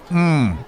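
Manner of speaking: high, falling "mm" of relish; appreciative hum over a mouthful; savouring hum. Acted. Savouring another bite.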